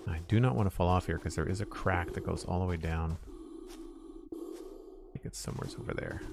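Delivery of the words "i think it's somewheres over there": hushed slow whisper. Real, not acted. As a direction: whisper it slowly to yourself.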